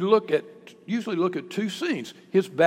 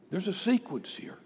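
normal talking voice

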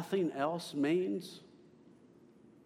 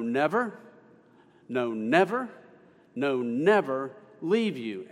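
insistent, separated "no, never", louder on each "never"; emphasis on NEVER; 4 dB louder than usual. Shown as a demonstration. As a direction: hammer each "never" with a pause after it. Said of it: "You see the emphasis".

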